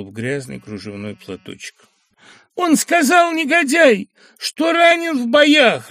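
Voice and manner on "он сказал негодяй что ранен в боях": high, loud, slow wailing through tears; sobbing. Acted. Young woman sobbing to the professor, high tearful wail. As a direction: wail it out as if weeping. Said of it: "рыдала барышня".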